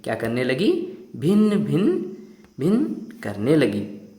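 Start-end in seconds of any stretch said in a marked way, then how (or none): 1.2-4.1 fly buzz imitation. Shown as a demonstration. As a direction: stretch each भिन out like a fly buzzing. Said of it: "मक्खी कैसे बोलती है?".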